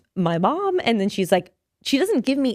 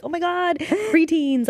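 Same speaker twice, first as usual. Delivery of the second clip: high-pitched mock shock; scandalized preteen thrill; 9 semitones higher, slower than usual. Young viewer watching risque show, high scandalized thrill. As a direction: gush it high like a thrilled kid.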